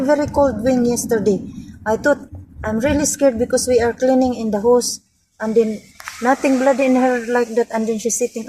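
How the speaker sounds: ordinary talk, nothing marked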